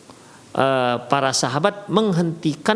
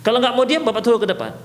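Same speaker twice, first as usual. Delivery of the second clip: raised-pitch threat to a chatty pupil; stern teacher warning; 7 semitones higher, much faster than usual. Shown as a demonstration. Teacher warning chatting pupils at the back, stern higher voice. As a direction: warn them off, firm and pitched up. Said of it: "ada intonasi larangan".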